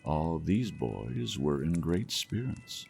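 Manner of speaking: ordinary delivery, nothing marked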